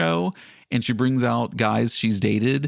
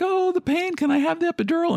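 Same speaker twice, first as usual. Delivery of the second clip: mock woman in labor.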